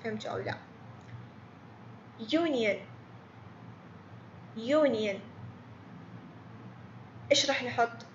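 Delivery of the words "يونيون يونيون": "يونيون" with a clear "yoo" start, said twice; teacher reads the quiz word; model word. Shown as a demonstration. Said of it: "نسمع الكلمة".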